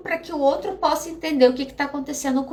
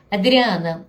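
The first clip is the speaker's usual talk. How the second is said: ordinary talk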